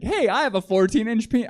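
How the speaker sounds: proud and pleased, played for laughs; happier. Stalker boasting the way he should, mock-happy. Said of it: "you'd think you'd be happier about it".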